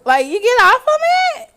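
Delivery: high, loud disbelief; incredulous. Real, not acted. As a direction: ask it in total disbelief. Incredulous question about eating fetish.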